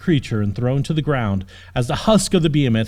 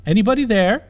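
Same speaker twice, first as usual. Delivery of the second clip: calling out; 8 semitones higher than usual. Acted. Princess calling to the unseen stranger. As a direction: call out toward the racket. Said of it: "she called out".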